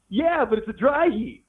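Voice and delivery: high, slow quote of a film line; slow, high-pitched movie impression; imitated movie character. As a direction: draw it out high, mimicking the movie guy. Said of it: "he was the guy that said".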